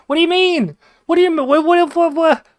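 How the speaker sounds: very high, loud, incredulous yelling; exasperated mock screech. Family answering dad's spider mistake, hammed-up exasperated shouting. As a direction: screech it in disbelief till words collapse.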